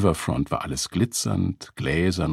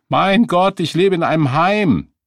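high, loud exasperation; exasperated; 11 semitones higher, 7 dB louder than usual. A narrator's reading. Beggar questioned about being homeless, exasperated outburst. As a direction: burst out, fed up with being questioned.

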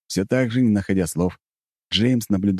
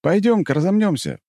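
cheerful call; 13 semitones higher than usual. Acted. Inviting bear to fight.

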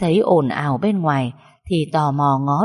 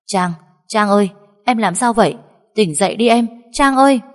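desperate husband crying her name, louder and higher; frantic calling; 4 semitones higher, 5 dB louder than usual. Acted. Husband kneels and calls his fallen wife, frantic. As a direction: call her back to consciousness. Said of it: "mà cố gọi".

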